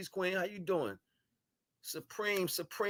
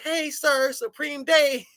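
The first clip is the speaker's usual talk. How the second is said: put-on much higher, louder little-kid voice; four-year-old voice; 13 semitones higher, 12 dB louder, slower than usual. Imitating a four-year-old greeting him, high child voice. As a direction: squeak it out like a tiny child greeting a grown man. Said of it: "like a four-year-old came over and said".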